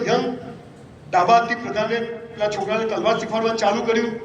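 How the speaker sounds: ordinary delivery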